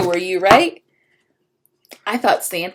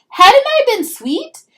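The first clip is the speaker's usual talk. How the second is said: very high, loud, slow worried question; dismayed; 12 dB louder, slower than usual. Acted. Pinkalicious wonders if she was bad, high dismayed wail. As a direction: wail it in disbelief.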